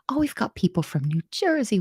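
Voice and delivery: ordinary delivery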